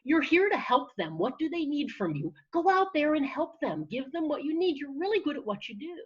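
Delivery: lifted, upbeat voice of encouragement; supportive friend. Acted. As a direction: reassure a nervous friend with conviction. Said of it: "You would instead say something like".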